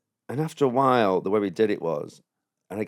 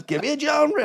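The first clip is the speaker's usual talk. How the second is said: very high-pitched call for suggestions; hyped improv host. Retold or imitated. Improviser asking the audience for suggestions, hyped. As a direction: pitch it up to rally the crowd.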